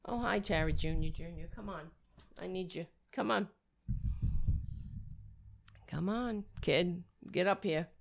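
hushed, coaxing the cat over; soft cat talk. Real, not acted. Man coaxing his cat to jump up, softly coaxing. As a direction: coax softly, like calling a pet.

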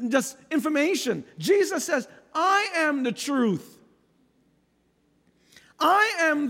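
high, emphatic pulpit proclamation; impassioned preaching. Real, not acted. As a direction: proclaim it to the congregation, stretching key words. Preaches at full force.

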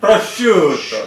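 exaggerated Italian Prosciutto with rolled r and long u; hammed-up Italian Prosciutto; mock Italian. Shown as a demonstration. Friends mocking the pronunciation, in a hammed-up Italian accent. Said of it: "Ziceți după mine".

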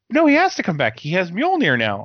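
high, whiny protest from a friend; imitating a friend's high-pitched objection; mock friend protest. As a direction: squeal it like a fan in denial. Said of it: "one of my friends is like".

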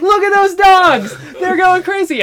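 high, loud, excited crowd yell; excited onlookers. Retold or imitated. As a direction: shout it like amazed bystanders. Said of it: "people are like".

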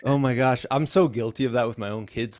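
ordinary talk

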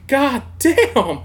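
high, loud exclamation; hammed-up shocked reaction; mock dismay. Prisoner reacting to mean sheriffs, mock dismay. Acted reaction to being shoved.

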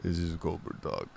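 a hint of a low, soft gruff Cobra voice; Cobra Talk impression. Quoting the comedian's bit to his co-host, low gruff voice. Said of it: "Cobra Talk, where he pretends to be".

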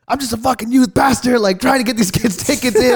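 high, protesting mock yell; yelling back. Supposed youth pastor defending himself to a fan, retold yelling. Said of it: "he's yelling at me".

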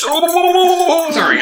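high sustained silly voice; character voice. Acted. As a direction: stretch it in a goofy falsetto. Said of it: "Clive Smith".

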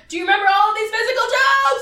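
loud, high mocking question; mock exasperated shout. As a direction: shout it at the screen in disbelief.

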